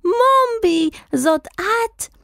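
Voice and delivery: very high, drawn-out cry; piercing shout. A narrator's reading. Wizard rushing at the hen, crying out in wild recognition. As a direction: shout it high and stretch the last word. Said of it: "הצעקה קרעה את האוויר".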